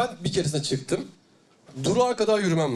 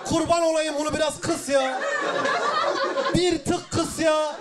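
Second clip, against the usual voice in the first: pleading shout; 10 semitones higher than usual. Retold or imitated. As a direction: yell up at God begging him to turn down the heat. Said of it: "Bir tane abi bağırdı". (göğe yalvararak bağırma)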